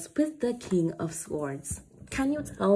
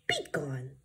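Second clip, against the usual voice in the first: hushed banish; 6 semitones lower, 7 dB quieter than usual. Acted. Banishing demons, repeated quieter.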